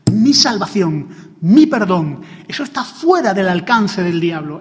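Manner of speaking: forceful preaching. Acted. Preacher proclaiming salvation is beyond the devil's reach, loud and defiant. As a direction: declare it against the devil.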